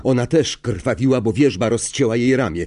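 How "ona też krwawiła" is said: usual speaking voice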